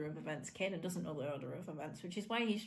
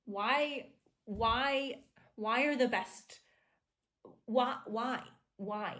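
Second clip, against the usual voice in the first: lamenting why; 4 semitones higher, 5 dB louder, much slower than usual. Real, not acted. Lamenting a character's death.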